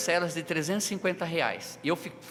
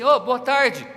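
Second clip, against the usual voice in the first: loud, higher hail from a stranger; calling out; 6 semitones higher, 10 dB louder, slower than usual. Retold or imitated. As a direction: call out to get his attention. Stranger calls out on street.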